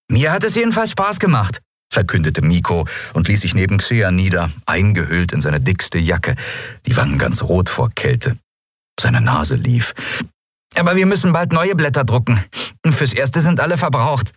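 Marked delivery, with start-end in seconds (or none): 0.1-1.6 high-pitched, lively little character voice; high young storybook voice; young character Miko. A narrator's reading. 10.8-14.3 high, eager little character voice; high-pitched youngster in a story; young character Miko. A narrator's reading. Miko's dialogue in narrated story.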